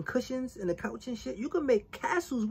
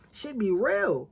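ordinary delivery

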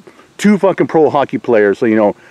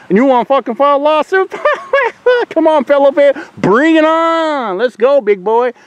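mocking taunt; 12 semitones higher than usual. Real, not acted. Taunting troll with mocking laugh.

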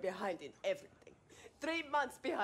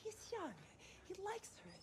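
hushed pleading; 13 semitones higher, 10 dB quieter, faster than usual. Acted. Mother pleads quietly with husband.

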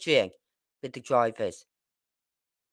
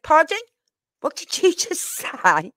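retold shocked grandmother, high and loud; shocked telling-off; 13 semitones higher, 4 dB louder than usual. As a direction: act out a scandalised "pardon?", pitched high. Grandmother scolding child for swearing.

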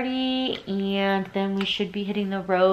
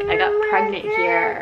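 fighting back real tears on the memory; emotional crack; 12 semitones higher than usual. Real, not acted. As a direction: voice breaks high as she tears up. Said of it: "I'm feeling emotional".

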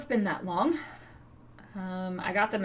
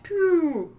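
high, loud descending whistle-like "pew"; falling plummet noise; 9 semitones higher, 7 dB louder than usual. Retold or imitated. Vlogger showing how viewership fell, plummeting sound effect. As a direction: imitate something dropping away, pitch sliding down.

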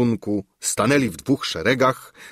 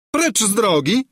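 high, loud contemptuous cry; haughty shout; 12 semitones higher, 4 dB louder than usual. A narrator's reading. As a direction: bark her out of the way, much higher. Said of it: "zawołała Gburia Furia". (wysoki, głośny, pogardliwy okrzyk)

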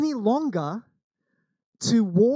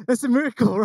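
louder, higher mock agreement; husband agreeing; 6 semitones higher, 5 dB louder than usual. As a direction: playfully echo the wife. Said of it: "he's going".

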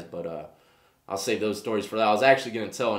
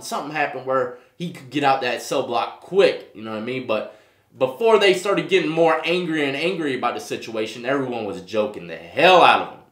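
usual speaking voice

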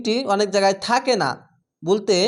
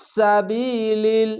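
slow, level tajweed word; Quran recitation; much slower than usual. Shown as a demonstration. Teacher modelling a word for students, slow recitation. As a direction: recite it with the long 'i' held.

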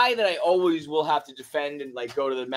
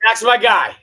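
excited; 7 semitones higher, 8 dB louder than usual. Real, not acted. Picking his guy, excited.

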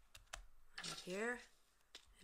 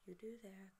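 whisper; 2 semitones higher, 8 dB quieter than usual. Real, not acted. Puzzled at snowman.

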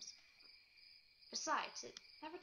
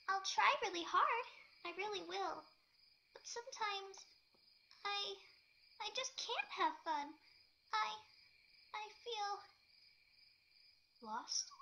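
higher, broken, stammering and slow; anguished; 9 semitones higher, 5 dB louder than usual. A narrator's reading. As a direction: push out each word against tears. Pinkie's halting anguished confession.

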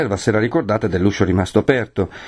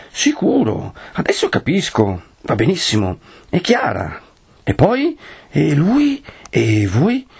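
feigning keen interest to keep her chatting; exaggerated attentive prompts, questions swooping upward; finto interesse; 6 semitones higher, slower than usual. A narrator's reading. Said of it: "per far vedere che stava attenta". (finto interesse, per tenerla a chiacchierare)

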